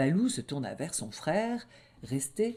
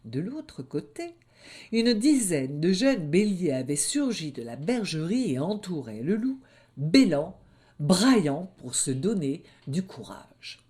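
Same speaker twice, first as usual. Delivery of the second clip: usual speaking voice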